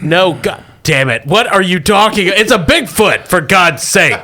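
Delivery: high, rising exasperated outburst. Acted. Boss corrects agent's tater tot guess, exasperated. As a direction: scold a clueless agent, thoroughly fed up.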